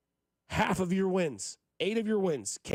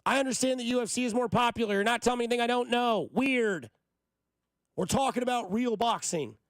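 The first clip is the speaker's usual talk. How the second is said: higher, heated, insistent rant; mad rant; 8 semitones higher than usual. Real, not acted. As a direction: vent real frustration at the critics. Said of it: "I'm just mad".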